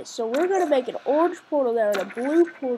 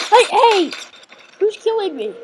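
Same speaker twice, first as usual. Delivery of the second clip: surprised yell; 9 semitones higher, 7 dB louder than usual. Real, not acted. Suddenly shot by turrets.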